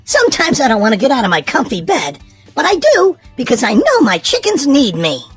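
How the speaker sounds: ordinary delivery, nothing marked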